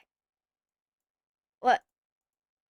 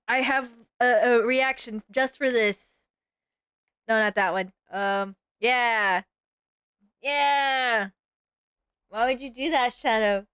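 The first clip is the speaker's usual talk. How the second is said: ordinary delivery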